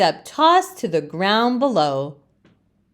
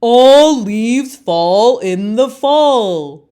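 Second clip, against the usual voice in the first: slow, louder chorus of leaves; know-it-all leaves; 3 semitones higher, 7 dB louder, slower than usual. Acted. Other leaves scold Leaf, slow and loud. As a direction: lecture it slowly like smug elders. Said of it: "the other leaves say again".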